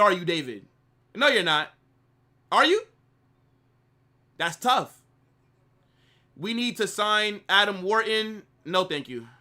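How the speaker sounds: normal talking voice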